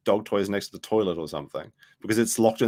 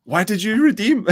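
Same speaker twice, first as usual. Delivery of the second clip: high, loud mock Indian accent; 13 semitones higher, 7 dB louder than usual. Streamer joking with viewers about Indians, loud high Indian accent. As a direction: wail it in a hammed-up Indian accent. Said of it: "Indians are funny".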